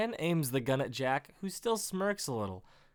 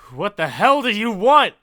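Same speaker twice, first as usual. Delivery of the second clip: loud, higher outburst; alarmed demand; 5 semitones higher, 13 dB louder than usual. Acted. Wounded writer confronts the gunman, loud and exasperated. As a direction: yell it at your attacker.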